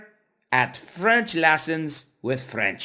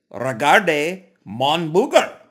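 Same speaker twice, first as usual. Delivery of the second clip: louder drill; 3 semitones higher, 7 dB louder than usual. Shown as a demonstration. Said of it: "Say it with me".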